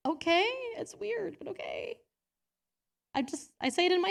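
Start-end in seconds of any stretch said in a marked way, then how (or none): 0.1-2.0 awkward acceptance. Retold or imitated. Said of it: "I'm just like".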